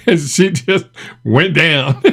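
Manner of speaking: slow words squeezed through real laughter; barely getting it out while laughing; laughing while talking. Real, not acted. Telling viewers the clip cracks him up, through real laughter.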